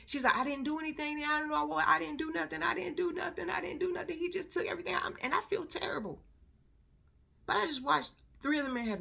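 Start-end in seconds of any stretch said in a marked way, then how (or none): 0.4-4.9 high-pitched retold crying plea; high, pleading imitation climbing in pitch; imitated boo-hooing. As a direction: mimic a woman wailing her innocence, tearless. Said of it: "Boo-hooing".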